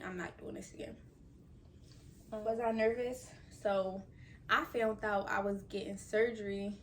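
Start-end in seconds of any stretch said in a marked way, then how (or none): 0.0-1.0 whispered aside. Real, not acted. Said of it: "But I know me".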